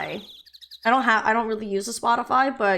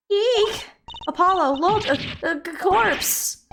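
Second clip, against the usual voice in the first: high squeal, stammering on corpse; acted fright; 8 semitones higher, slower than usual. Character shows Apollo a corpse, acted panic. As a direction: shriek in mock terror, then stutter.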